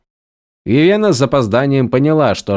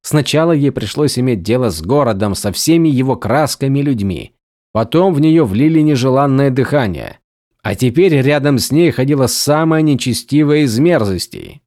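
ordinary delivery

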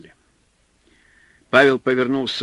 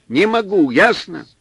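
exasperated snap; 7 semitones higher, 6 dB louder than usual. A narrator's reading. Exhausted man snapping back.

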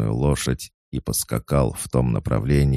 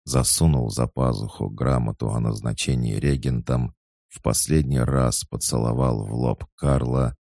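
ordinary delivery

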